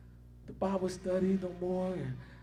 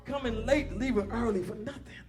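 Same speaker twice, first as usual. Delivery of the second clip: louder preaching, driving through each phrase; preaching at full force; 2 semitones higher, 4 dB louder than usual. Acted. Preacher rebuking late and absent churchgoers, in forceful preaching. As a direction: press the congregation hard.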